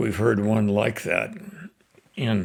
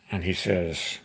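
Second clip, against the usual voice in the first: normal talking voice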